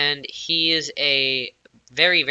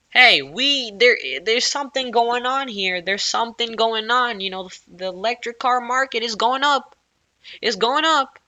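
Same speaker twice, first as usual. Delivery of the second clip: mock excited company; 8 semitones higher than usual. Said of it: "So Ford is like".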